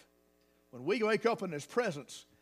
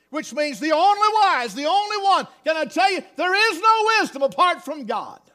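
loud, high, building proclamation; full-force preaching; 9 semitones higher, 10 dB louder than usual. Acted. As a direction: rise to a shout of praise. Preaching climax.

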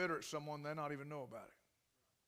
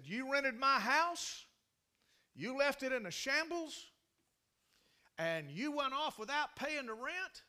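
angry accusation; 10 semitones higher, 9 dB louder than usual. Retold or imitated. Wronged landlady accuses the pastor, loud and outraged. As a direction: hammer each accusation home.

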